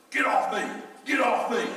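yelling get off. Retold or imitated. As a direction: struggle and yell from under him.

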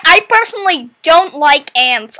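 usual speaking voice

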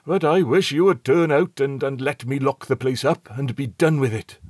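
impatient captain wanting to lock up; irritable, put-upon complaint. A narrator's reading. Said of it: "he replied rather petulantly".

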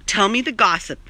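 ordinary talk

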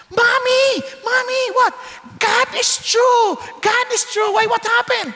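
put-on child running home to his mother, shouting with joy; excited child. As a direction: burst in like a thrilled child. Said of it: "umuwi yung bata".

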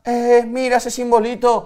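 high-pitched comic protest; mock indignation. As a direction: exclaim in exaggerated disbelief, high. Mock indignation at old app version. (indignación fingida, con voz aguda)

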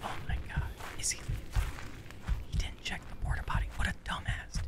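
tense, barely voiced whisper. Real, not acted. Hiding in the porta potty from the killer, whispering. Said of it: "We gotta be quiet".

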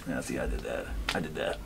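low soft growly villain; hint of a menacing anime villain; deep menacing voice. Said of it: "I think a little more smooth".

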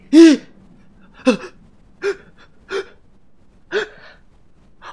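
loud high shriek on a gasp; high gasping scream. Real, not acted. Streamer startled by the game, shrieking gasp.

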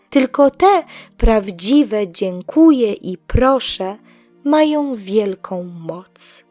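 plain reading voice

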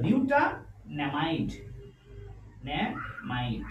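slow, syllable-by-syllable naming; slow dictation. Shown as a demonstration. Teacher writing out the compound's name for students, slow dictation. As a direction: draw out each part of the name.

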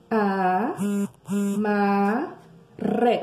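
"A, ma, re" split into slow separate syllables. Shown as a demonstration. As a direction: spell it out in pieces for children. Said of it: "Se a gente ler devagarinho". ("A, ma, re" bem devagar, sílaba por sílaba)

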